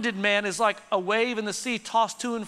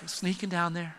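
hushed storytelling; 6 semitones lower, 8 dB quieter than usual. Acted. Narrating a sneaking approach.

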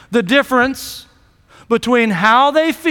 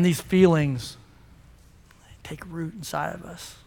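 quiet hush; 9 semitones lower, 9 dB quieter than usual. Acted. Pastor draws the congregation into their hurt, hushed. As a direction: lean in and speak low and close.

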